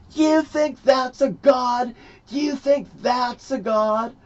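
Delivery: high, slow mock shout; imitating outraged woman. Woman confronting him about the picture on the wall, high outraged shout. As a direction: shriek it slowly in accusing disbelief. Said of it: "And she goes".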